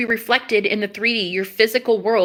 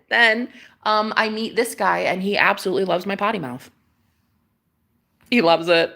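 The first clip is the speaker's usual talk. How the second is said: usual speaking voice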